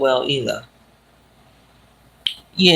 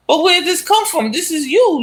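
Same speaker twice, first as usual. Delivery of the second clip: much higher, pressing disbelief; husband confronting his wife, high and incredulous; baffled husband; 12 semitones higher than usual. Retold or imitated. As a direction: demand answers in shock. Husband confronts wife about pregnancy.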